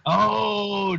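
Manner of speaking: sudden high loud oh of dismay; startled oh. Real, not acted. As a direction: yelp as the mistake dawns on you. Sudden realization of a problem.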